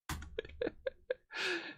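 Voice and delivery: high, breath-only giggling; a wheezy little fit of laughter; stifled high laugh; giggle. Real, not acted. Laughs at own typed command.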